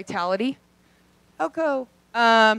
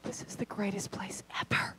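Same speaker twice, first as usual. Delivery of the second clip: awed aside, whispered; 4 semitones lower, 13 dB quieter than usual. Retold or imitated. Woman whispers to herself at hotel desk, delighted. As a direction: breathe it to yourself in delight. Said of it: "I was like".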